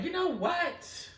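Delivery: drawn-out awed you know what; mock amazement. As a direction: linger on it in wonder. Amazement at the cat's return.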